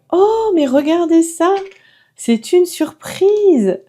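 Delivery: high, loud delighted gasp, then slowly stretching out the surprise; excited surprise. Real, not acted. Vlogger unboxing a palette for her viewers, thrilled and excited. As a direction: show the viewers your thrill at the find.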